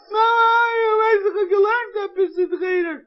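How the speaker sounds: put-on high, loud sobbing boy; little boy wailing to mom; crying child. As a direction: blurt it through tears.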